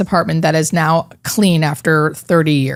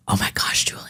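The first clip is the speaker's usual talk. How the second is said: breathy hushed awe, nearly a whisper; almost whispering; 10 dB quieter than usual. Retold or imitated. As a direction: whisper it in amazed wonder. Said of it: "Like, almost whispering".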